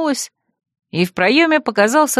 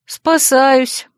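relieved, raised voice; relieved sigh; 5 semitones higher than usual. A narrator's reading. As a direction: breathe out the answer with relief. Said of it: "облегчённо вздохнула я".

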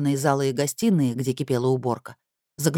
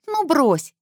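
smirking; 11 semitones higher than usual. Acted. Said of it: "усмехнулась Кассандра".

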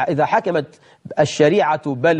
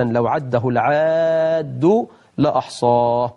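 slow counted words; 3 semitones lower, much slower than usual. Shown as a demonstration. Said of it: "كلامه يعده العاد".

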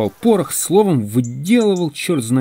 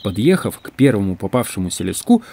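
plain reading voice

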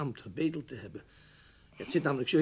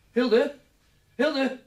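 loud high urgent calls; calling out; 11 semitones higher, 4 dB louder than usual. Acted. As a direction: shout up the stairs for her. Roept Hilde vanuit de gang. (luid roepend naar boven)

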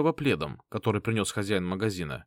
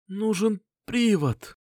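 wounded Lin; 10 semitones higher, slower than usual. A narrator's reading. Wounded Lin voiced by narrator.

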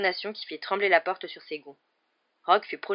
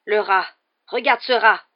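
loud, raised, insistent cries; desperate urging; 4 semitones higher, 13 dB louder, slower than usual. A narrator's reading. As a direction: make them look, shout it urgently. (cri pressant et désespéré)

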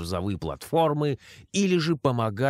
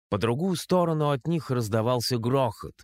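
ordinary delivery

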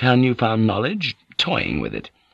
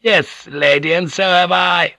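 higher, lightly roughened reply; a touch of roughness, pitched up; rough voice; 9 semitones higher than usual. A narrator's reading. Offended guest answering the lady's dismissal, slightly rough. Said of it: "her voice rough".

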